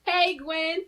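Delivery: ordinary delivery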